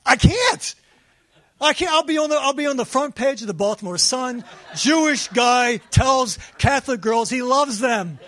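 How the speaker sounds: high, loud frantic pleading; anxious high-pitched refusal; panicked protest. Retold or imitated. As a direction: panic at the thought. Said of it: "I'm saying to my".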